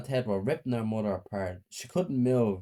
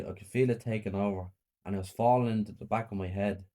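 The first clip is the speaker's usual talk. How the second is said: plain reading voice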